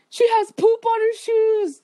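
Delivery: put-on high, slow taunting kid voice; mocking classmate. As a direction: announce it to the whole class, teasing. Classmate announcing it in story.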